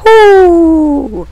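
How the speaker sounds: long, very high, loud whoop; victory whoop. Real, not acted. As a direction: let out a sustained triumphant woo. Whoops after qualifying.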